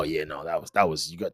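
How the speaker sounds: ordinary talk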